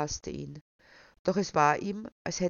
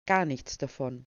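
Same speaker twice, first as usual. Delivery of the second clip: low-pitched curt refusal; terse, matter-of-fact; 4 semitones lower than usual. A narrator's reading. Man refuses his fiancée's rebuilding plans, lapidary. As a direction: shut it down in a lower voice. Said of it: "meinte Sebastian lapidar".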